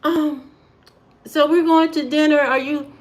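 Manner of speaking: high-pitched, hesitant woman being asked out; imagined date's voice. Acted. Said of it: "And you be like".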